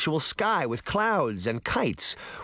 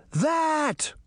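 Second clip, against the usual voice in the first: shouted. Acted. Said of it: "Sabrina and Daphne shouted in unison".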